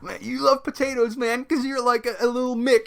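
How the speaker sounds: high, dopey dude delivery. Retold or imitated. Retelling the GM insulting an Irish grandma, high mock bro voice. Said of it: "he was like".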